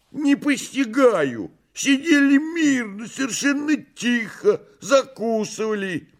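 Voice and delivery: very high, theatrical bewilderment; exclaiming. A narrator's reading. Behemoth explaining the fire to messire, high exclaiming voice. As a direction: exclaim it high, all baffled innocence. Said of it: "а Бегемот вскричал".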